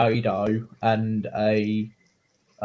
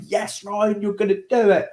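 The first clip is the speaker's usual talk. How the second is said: imitating a cheering mum, pitched up; 7 semitones higher than usual. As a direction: lightly act out a proud mum's encouragement. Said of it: "my mum was, like, going".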